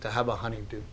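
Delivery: soft, subdued wistful quote; emotional. Real, not acted. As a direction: let the voice sink, moved. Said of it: "I'm getting emotional right now thinking".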